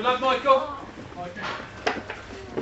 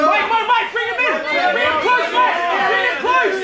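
high, insistent shout from the corner; urgent coaching shout; 8 semitones higher, much faster than usual. Real, not acted. Coach telling Mike to close in, urgent shout. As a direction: shout to get the fighter's attention.

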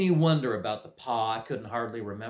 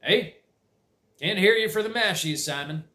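lightly voiced old farmer, raised; gruff uncle voice; 7 semitones higher, 4 dB louder than usual. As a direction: call across the noisy table. Uncle calls to Simon.

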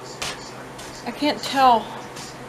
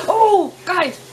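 high, louder burst of surprise; sudden remembering; 7 semitones higher, 4 dB louder than usual. Real, not acted. Vlogger suddenly remembers to tell viewers, surprised. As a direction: blurt it as it hits you.

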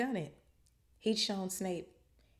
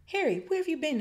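high, loud squeak; Hermione squeaking; 12 semitones higher, 6 dB louder than usual. A narrator's reading. Friend greets Harry on his return, squeaky. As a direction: squeak it out, pitched way up. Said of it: "Hermione squeaked".

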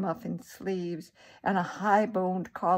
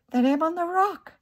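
ordinary delivery

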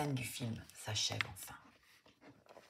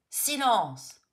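loud, higher shout of silence; yelled; 8 semitones higher, 9 dB louder than usual. A narrator's reading. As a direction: director yelling for silence. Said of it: "hurle à nouveau la voix".

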